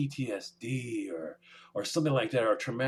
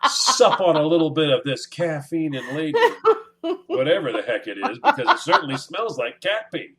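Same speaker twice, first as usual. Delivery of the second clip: higher, louder mock hostess voice, laughing through; mock polite guest; 6 semitones higher, 6 dB louder than usual. To co-host mocking a cat owner's visit, laughing mock cheer. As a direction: ham up the cheery guest, cracking up. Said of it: "I don't wanna sit down and go".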